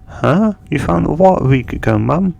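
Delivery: high, fast, startled asking; surprised question. Retold or imitated. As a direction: caught off guard by mum's news. Son surprised by mother's find.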